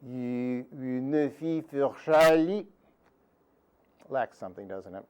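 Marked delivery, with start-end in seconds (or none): 0.0-2.7 "you ne fit for Charlie" in a mock French accent. The French-accented prince mangling his own song, mock French accent. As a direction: sing it like a Frenchman mangling Scots. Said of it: "With his French accent".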